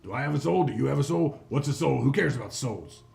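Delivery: loud, scornful hobgoblin; callous hobgoblin boss. Acted. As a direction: brush it off with contempt. Hobgoblin mocks question of souls.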